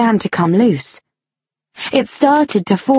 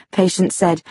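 ordinary delivery

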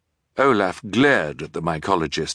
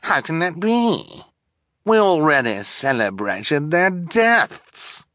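high-pitched theatrical disbelief; affected villainess, incredulous; astonished; 9 semitones higher than usual. A narrator's reading. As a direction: protest in drawn-out amazement. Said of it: "she asked in astonishment".

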